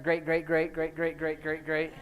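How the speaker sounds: evenly chanted string of greats; monotone, rhythmic repeating; rattled-off repetition. Shown as a demonstration. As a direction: tick off each generation in a steady run. Rattling off a long chain of generations.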